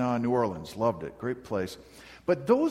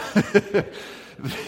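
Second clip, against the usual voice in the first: real laugh; 8 semitones higher, 7 dB louder than usual. Real, not acted. Laughing at teasing Judy.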